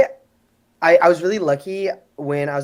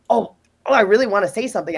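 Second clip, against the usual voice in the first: higher, louder, eager burst; passionate; 5 semitones higher, 5 dB louder, faster than usual. Retold or imitated. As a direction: retell it as an urgent bid to get a word in. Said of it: "just very, like, passionate".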